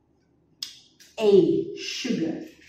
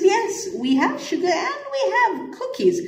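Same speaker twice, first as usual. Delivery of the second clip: put-on cheerful boy; 14 semitones higher than usual. Teacher voices boy again.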